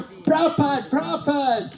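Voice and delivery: high, eager calls of the crowd; mock eager calling. Crowd greeting their guru with mock excited calls. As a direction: call his name like an adoring fan.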